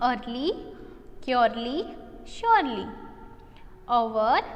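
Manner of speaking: each word said slowly and clearly on its own; model pronunciation. Shown as a demonstration. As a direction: model the words for the class to repeat. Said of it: "मैंने जैसे उसको pronounce किया वैसे ही आपको".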